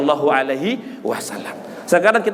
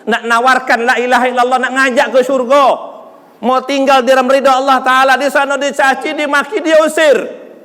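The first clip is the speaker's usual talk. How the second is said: high, mounting sermon delivery; impassioned preaching; 6 semitones higher, 4 dB louder than usual. Real, not acted. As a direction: build the list to a ringing finish. Preaches at full force. (berkhotbah berapi-api, makin meninggi)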